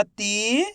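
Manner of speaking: "ती" with a long stretched ee, rising; drawn-out vowel. Shown as a demonstration. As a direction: stretch the vowel of "ती" as a reading demo. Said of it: "खींच के पढ़ेंगे".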